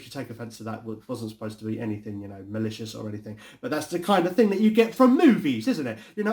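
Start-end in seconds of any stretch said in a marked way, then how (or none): none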